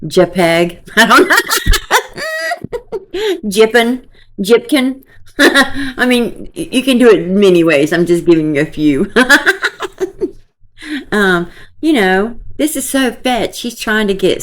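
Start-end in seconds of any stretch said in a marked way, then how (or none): 1.4-3.4 high, wheezing burst of laughter. Real, not acted. Vlogger cracks up at her own name puns, laughing high. 9.2-10.3 a high giggle that rises at the end. Real, not acted. Vlogger giggles at her own couple-name jokes.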